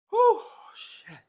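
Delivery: breathless whispered strain; strained gasp. Real, not acted. Lifting alone on stream, straining whisper. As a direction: squeeze it out mid-lift. Straining under heavy lift.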